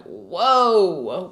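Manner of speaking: a loud mock whoa of sudden clarity; imitating a whoa. Imitating a glasses wearer seeing clearly, mock amazed.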